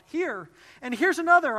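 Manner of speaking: high, loud distressed wail; panicked wail. A narrator's reading. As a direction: wail in dismay at the fleas. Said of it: "I wailed".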